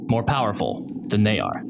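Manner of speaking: ordinary delivery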